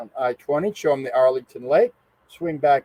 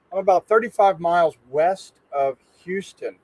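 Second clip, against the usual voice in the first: ordinary talk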